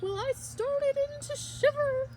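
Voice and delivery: usual speaking voice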